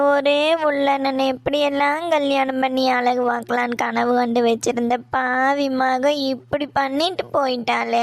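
sobbing lament with stretched endings; tearful wailing. Acted. Mother mourning her lost wedding dream, wailing in tears. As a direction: cry it out, dragging the final words into a wail. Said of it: "இப்படி அழுதுட்டே இருந்து".

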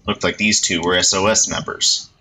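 plain reading voice

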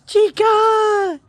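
high delighted call of the name; excited squeal. Real, not acted. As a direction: squeal the dog's name with joy. Calling the dog.

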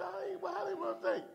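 high, soft puzzled voice; retold bewilderment in a small high voice; mock surprise. Voices surprised reaction to phone advert.

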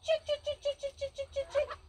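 high sing-song chicken call. Real, not acted. Calling hens to treats.

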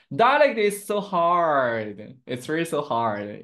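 loud, drawn-out lament; long, loud groan of complaint; exasperated complaint. Real, not acted. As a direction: stretch it out in playful despair. Playful complaint about hard dialects.

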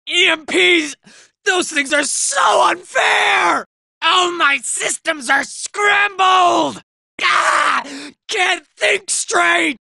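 dazed and glitching; scrambled by EMP. Acted. Robot complains to squad while EMP scrambles him, dazed and strained.

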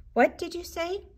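high gentle mother voice. A narrator's reading.

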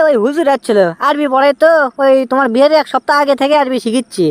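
ordinary delivery

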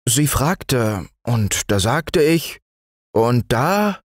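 put-on flustered boy faltering; confused stammer. A narrator's reading. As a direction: grope for an excuse, breaking off. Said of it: "stotterte Fritz verwirrt".

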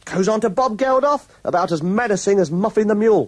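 normal talking voice

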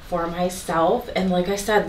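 ordinary talk

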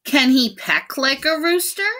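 high bossy hen voice; a bossy hen asking loudly. A narrator's reading. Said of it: "said one bossy hen".

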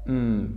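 "Mm" hummed low and soft; letter M sound. Shown as a demonstration. Teacher demonstrating the M sound, soft hummed "Mm". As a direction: give a quiet, closed-lip "Mm". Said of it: "It is pronounced like this".

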